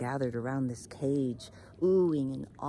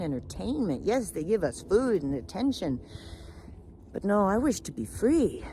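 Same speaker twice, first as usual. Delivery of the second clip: emphatic, louder lament; aggrieved protest; 3 semitones higher, 4 dB louder than usual. Acted. Caged peacock tells raven of its misery, aggrieved protest. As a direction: pour out the grievance with rising force.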